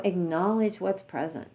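normal talking voice

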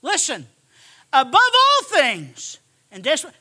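ordinary talk

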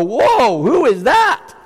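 hammed-up delight at spotting a star recruit; mock amazed Jesus. Preacher mocking a Jesus who picks the shining ones, amazed. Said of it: "Jesus will walk by, and he'll go".

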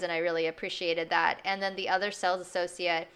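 usual speaking voice

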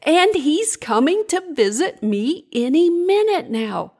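high, excited storybook character; thrilled little bug, voice sailing high; gasping excitement. A narrator's reading. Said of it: "gasped Larissa".

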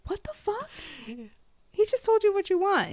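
high, squeaky disbelief; incredulous. Retold or imitated. Reacting to the woman flipping his food, high incredulous protest. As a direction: exclaim it high-pitched, appalled. Voices own disbelief at the scene.

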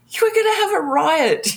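high-pitched, thrilled mock Iggy; excited Iggy. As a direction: squeal it like an overjoyed friend. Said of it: "he was so excited".

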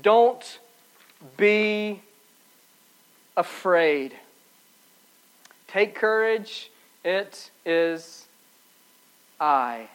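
soft, low, long pauses between words; slowly calm them, letting each phrase settle; gentle reassurance. Acted. Preacher speaks Jesus' words to frightened disciples, hushed and reassuring.

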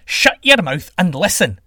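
loud demand easing into a question; commanding sky god. Acted. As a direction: order your rival to listen.

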